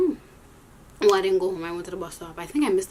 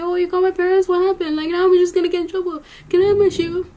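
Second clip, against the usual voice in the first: higher, louder, hammed-up dismay over the phone call; fake outrage; 9 semitones higher, 8 dB louder than usual. Girl fakes outrage at the teacher for calling her parents, higher and louder. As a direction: put on a shocked, indignant act. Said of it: "So I had to pretend now".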